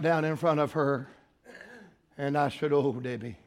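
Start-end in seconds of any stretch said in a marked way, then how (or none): 2.7-3.4 low, soft, gentle coaxing; tender counsel. Retold or imitated. Preacher kneeling before Debbie, tender counsel. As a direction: soothe her softly, pausing between phrases.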